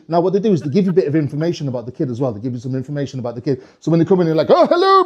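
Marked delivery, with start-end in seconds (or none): 4.5-5.0 high, swooping hello; jolly Santa greeting. Acted. Santa to arriving kid, jolly high greeting. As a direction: greet the child grandly, pitched right up.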